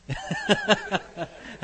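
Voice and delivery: loud, high burst of laughter; real amused laugh; hearty laugh. Real, not acted. Amused at a new answer in class.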